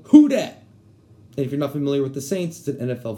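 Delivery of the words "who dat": fan yell. Shown as a demonstration. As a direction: holler it like a football chant. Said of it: "they yell".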